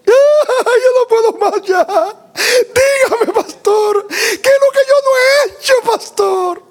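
mock hysterical wail, extremely high and stretched; crying outburst. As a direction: imitate her breaking down, screaming in tears. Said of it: "Y ahí ya soltó ... después que gritó". (grito llorado y desesperado, imitado)

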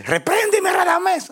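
high pleading devil voice, squeaky and mocking; devil begging. Preacher mimics the devil begging to be rebuked, high squeaky plea.

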